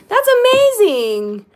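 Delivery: high, loud and drawn out; big excited squeal of approval; delighted congratulation. Real, not acted. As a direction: gush slowly over a friend's news. Cheering a viewer's good news.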